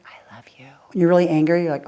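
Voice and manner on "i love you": very soft whisper, barely audible; whispered endearment. Acted. Someone coming in to lift an angry person's mood, whispered. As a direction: whisper it as if slipping in to surprise someone. Imagined person comes in saying it.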